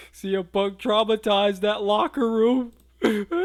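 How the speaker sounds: words breaking into loud high laughter; can barely get it out for laughing; real helpless laughter over the words. Real, not acted. Cracking up at the footage.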